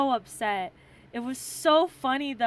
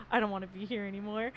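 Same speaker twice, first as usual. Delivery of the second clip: fast, softer, laughing whine; laughing mock sulk; 4 semitones lower, 7 dB quieter, much faster than usual. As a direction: mimic a sulky friend while laughing. Quoting friend's mood, laughing.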